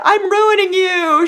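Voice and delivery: high, loud mock wail; mock distress. Mom confessing to imagined daughter, mock wail. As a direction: wail it with hammed-up despair.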